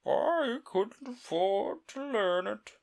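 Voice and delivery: high, slow, sighing turtle. Acted. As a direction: let it out with a sigh. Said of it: "with a sigh".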